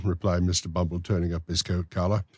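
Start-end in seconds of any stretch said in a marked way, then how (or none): none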